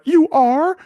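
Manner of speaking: high, loud, delighted question; mock wide-eyed girlfriend. Woman hears boyfriend will care for her, mock amazement. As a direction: squeal it as the dazzled girlfriend. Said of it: "And she's like".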